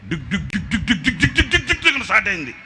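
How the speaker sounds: fast, laughing dig dig engine noise; laughing engine imitation. Preacher imitating the engine starting, laughing and fast.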